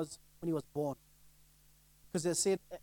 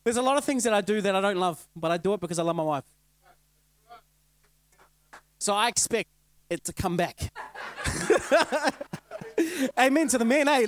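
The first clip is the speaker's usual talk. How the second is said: loud, fired-up preaching, half laughing; full-force preaching; 2 semitones higher, 8 dB louder than usual. Acted.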